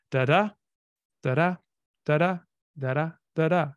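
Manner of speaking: each "da da" said apart, second syllable stressed; one "da da" foot at a time, weak then strong; separate iambs. Shown as a demonstration. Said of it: "unstressed, stressed".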